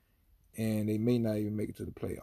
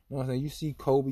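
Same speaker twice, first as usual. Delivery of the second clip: ordinary talk, nothing marked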